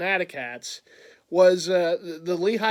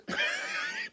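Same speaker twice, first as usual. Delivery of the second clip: laughing; 9 dB quieter than usual. Real, not acted. Laughing about panic buying.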